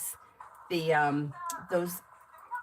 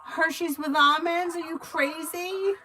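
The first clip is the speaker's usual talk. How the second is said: playfully scandalized at her choices; incredulous; 9 semitones higher than usual. Retold or imitated. Reader mocking Avery's chocolate picks, hammed-up incredulous. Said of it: "I'm like".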